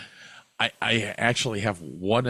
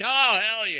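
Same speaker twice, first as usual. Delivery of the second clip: much higher, eager approval; enthusiastic; 12 semitones higher, 4 dB louder than usual. Retold or imitated. Imitating fans' reaction, much higher eager approval. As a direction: cheer it. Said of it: "they'd be like".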